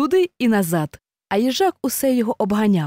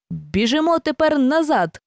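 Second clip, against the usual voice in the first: higher, firm order; commanding; 6 semitones higher than usual. Acted. Hare ordering the run back, commanding. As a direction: command the rematch. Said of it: "скомандував".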